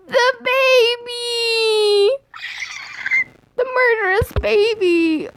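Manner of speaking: high, slow, hammed-up scream of terror; mock horrified shout. Reader shrieking at the murderous baby, hammed-up horror. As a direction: cry out in fake panic at the baby.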